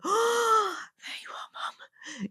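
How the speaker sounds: breathless, awed whisper to a newborn; tender whisper. Retold or imitated. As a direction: whisper in wonder, as if meeting the baby. Mother greeting newborn, imagined.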